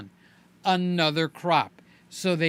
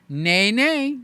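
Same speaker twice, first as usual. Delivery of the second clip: comedian catchphrase; 3 semitones higher, 5 dB louder than usual. Retold or imitated. Reacting to a YouTuber's bad advice, a louder comic nay nay. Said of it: "Like that comedian".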